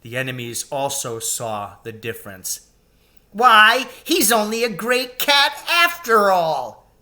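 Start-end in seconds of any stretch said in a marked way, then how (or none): 3.3-6.9 high, loud mocking voice; jeering creature. A narrator's reading. Enemy jeering at Aslan, high and loud. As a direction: sneer at the shorn lion. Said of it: "cried one".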